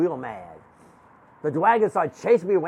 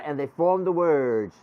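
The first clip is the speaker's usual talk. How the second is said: ordinary delivery, nothing marked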